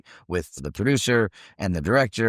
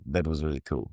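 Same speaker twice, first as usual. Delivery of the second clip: low, soft imitated Italian accent; 5 semitones lower, 6 dB quieter than usual. Assistant director praises the actor's long take, Italian accent. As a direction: confide it quietly with an Italian accent. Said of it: "in the Italian accent".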